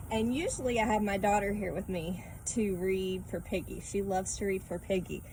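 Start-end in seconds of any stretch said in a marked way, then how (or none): none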